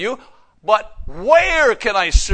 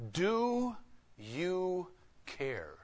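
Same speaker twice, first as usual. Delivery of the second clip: intimate quiet question; 15 dB quieter, much slower than usual. Acted. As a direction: ask it softly, one word at a time. Personal question to congregation.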